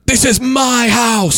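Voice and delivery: high, slow, screamed battle cry; screaming shout. Retold or imitated.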